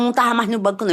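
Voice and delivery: ordinary delivery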